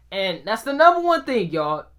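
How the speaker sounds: ordinary talk, nothing marked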